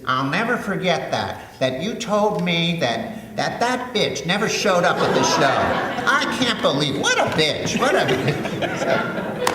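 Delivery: high, drawn-out incredulous retelling, breaking into laughter. Retold or imitated. Composer retells the album producer's memory, high-pitched mock outrage. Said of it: "he says".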